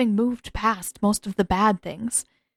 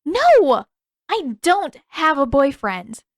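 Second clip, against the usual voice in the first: loud, high flustered protest; indignant denial; 6 semitones higher, 6 dB louder, slower than usual. Acted. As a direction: shout it down, embarrassed. Katara denies a boyfriend angrily.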